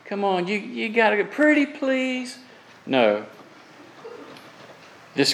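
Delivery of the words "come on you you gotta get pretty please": soft, coaxing and wheedling, rising high at the end; a limp, begging request to Abraham; half-hearted wheedling plea; mock pleading suggestion. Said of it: "It is not".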